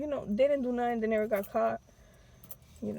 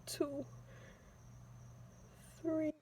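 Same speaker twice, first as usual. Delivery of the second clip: counting quietly; 5 semitones higher, 14 dB quieter, much slower than usual. Retold or imitated. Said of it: "praying and going".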